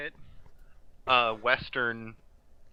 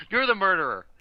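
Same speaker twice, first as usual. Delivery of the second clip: mock accusation; 9 semitones higher, 6 dB louder than usual. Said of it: "It's like".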